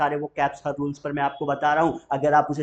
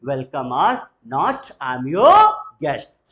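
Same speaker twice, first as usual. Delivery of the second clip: "guest" line swells louder at the end; slow, then punch "your guest"; slow model phrase; 7 dB louder, much slower than usual. Shown as a demonstration. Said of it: "बोलेंगे जरा". (धीमे, "your guest" पर ज़ोर)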